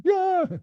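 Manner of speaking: high, yappy imitated bark; sudden high-pitched mock bark; dog bark. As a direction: yelp like a little dog. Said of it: "sound of a dog barking".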